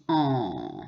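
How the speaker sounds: en as a long falling nasal vowel. Shown as a demonstration. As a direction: let en trail down, no N sounded. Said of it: "on prononce". (« en » nasal, sans prononcer le N)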